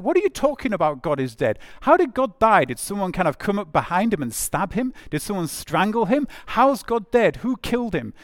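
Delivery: high, loud scoffing questions; mocking crowd. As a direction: jeer at him like a scornful townsman. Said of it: "people are mocking this madman".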